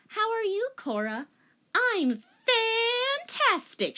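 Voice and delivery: put-on high, gushing girlish greeting; bubbly girl. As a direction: bounce it out. Voices bubbly Korra.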